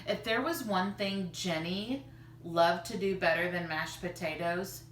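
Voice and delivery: usual speaking voice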